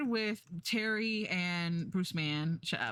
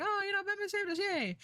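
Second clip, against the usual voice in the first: mock cheering crowd; 14 semitones higher than usual. Voicing cheering townsfolk.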